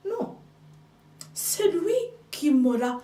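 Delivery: ordinary talk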